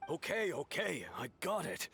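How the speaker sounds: high-pitched, irritated brush-off; exasperated. Acted. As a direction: cut off the lecture impatiently.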